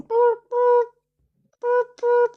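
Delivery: high beeping boops like a ringing phone; phone ring imitation. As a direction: mimic a telephone ring, high and even. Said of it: "there's the parrot going".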